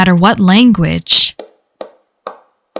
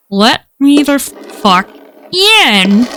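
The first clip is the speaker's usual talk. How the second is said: slow, higher-pitched inhuman speech; eerie, halting creature demand; halting, weirdly toned voice; 4 semitones higher, much slower than usual. A narrator's reading. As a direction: break the words apart as if unused to talking. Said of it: "halting, weirdly toned voice".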